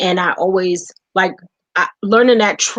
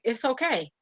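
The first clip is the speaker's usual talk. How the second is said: soft, gentle "It's okay."; reassuring; 4 semitones higher, 10 dB quieter than usual. Retold or imitated. Wife quoting husband reassuring her, soft. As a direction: soothe her softly.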